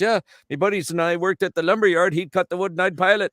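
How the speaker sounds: high, loud Newfie punchline; cheerful high-pitched Newfoundland accent. Acted. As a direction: explain brightly like a Newfoundlander. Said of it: "jump the pond over to Newfoundland".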